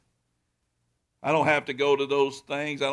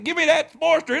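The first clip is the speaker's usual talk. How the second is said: much higher and louder, eager; eager demand; 11 semitones higher, 6 dB louder than usual. Retold or imitated. Pastor retells asking for the bike, eager and much higher. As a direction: demand the bike like a kid at a toy store. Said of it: "I said".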